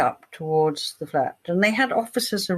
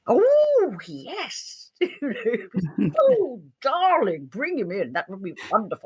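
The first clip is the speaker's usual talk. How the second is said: much higher, slow, breathless delight; gushing producers; 4 semitones higher than usual. Retold or imitated. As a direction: gush like thrilled TV executives.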